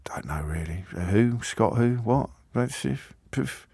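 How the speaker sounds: a half-whispered, low, throwaway mumble; mumbled indifference. Retold or imitated. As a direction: mimic someone who no longer cares, fading to nothing. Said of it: "Tenth time it happens".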